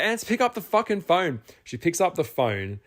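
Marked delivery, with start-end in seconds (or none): 0.0-1.4 high, frantic call down the line; urgent yell. Retold or imitated. After the phone hits the floor, calling to his friend: frantic yell. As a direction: demand she grab the phone now.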